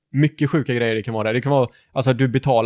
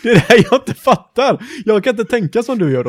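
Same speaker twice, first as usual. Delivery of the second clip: higher, louder protest with laughter; laughing exasperation; 7 semitones higher, 5 dB louder than usual. Real, not acted. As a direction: protest in amused frustration. Protests that rules keep changing. (uppgivet skrattande protest)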